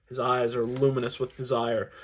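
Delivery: ordinary delivery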